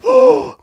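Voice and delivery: very high mock "oh"; imitated delighted gasp. Retelling friend seeing the knife picture, imitated gasp. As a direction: act out a high, impressed gasp.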